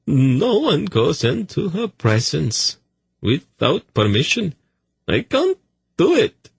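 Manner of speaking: high, slow, panicky pleading; a terrified fat eunuch refusing; frightened eunuch. Acted.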